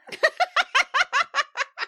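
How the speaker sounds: long, high burst of laughter; loud laughter. Real, not acted. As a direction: crack up loudly, high-pitched. Laughter after the ta-da.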